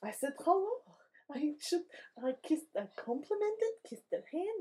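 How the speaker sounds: put-on slipping into a Russian accent. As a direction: Kurt voice that drifts Russian. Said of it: "That's a little Russian".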